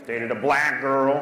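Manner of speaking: ordinary delivery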